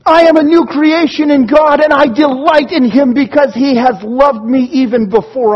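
high, loud triumphant cry, easing gradually; exultant proclamation starting at a yell; rejoicing. Acted. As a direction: shout it as joyful celebration. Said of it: "rejoice and go".

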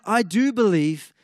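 desperate father crying out to Jesus. Retold or imitated. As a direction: cry out to Jesus for help. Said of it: "the man cries out".

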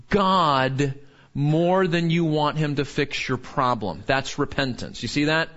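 ordinary delivery

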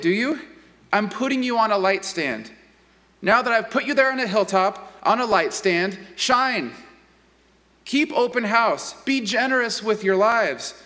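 earnest, lively pulpit reading; a lightly heightened preacher's reading; scripture reading. Acted. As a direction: read it as a direct personal address. Reading Message paraphrase aloud.

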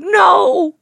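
a high, sharp mock dog refusal; imitating a dog. Sister plays a dog refusing the box, pitched high. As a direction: bark out a mock no. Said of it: "I was like that dog".

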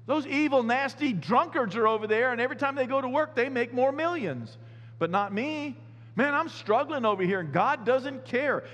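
envious complaint. Retold or imitated. Psalmist complaining the sinners get rich, whiny and high. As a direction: whine like a man who feels cheated. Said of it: "the circumstances around him are like".

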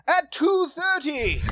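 high, slow, loud fake British announcer; hammed-up British accent, drawn out; mock British accent. Recruited performer reads out the schedule, bad British proclamation. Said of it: "Can you do a British accent?".

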